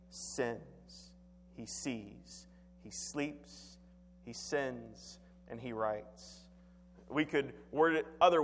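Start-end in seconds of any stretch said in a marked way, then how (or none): 0.1-6.4 hushed. Real, not acted. Hushed summary of David's sins.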